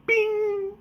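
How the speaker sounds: high sung ding; magic ding sound. Pretend. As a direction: chime a bright magic bing. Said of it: "That's my tea magic".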